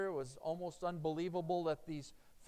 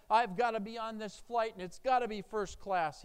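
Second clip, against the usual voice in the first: insisting; 6 semitones higher, 8 dB louder than usual. Acted. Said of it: "he insisted".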